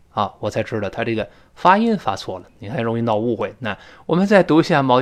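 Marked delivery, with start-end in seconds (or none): none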